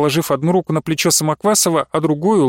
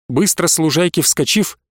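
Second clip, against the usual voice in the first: ordinary delivery, nothing marked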